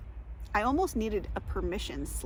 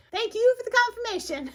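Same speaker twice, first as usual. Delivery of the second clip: high, loud put-on voice; mock thanks; 12 semitones higher, 7 dB louder than usual. Speaker thanks someone after flubbing a line, mock thanks. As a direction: call out exaggerated gratitude.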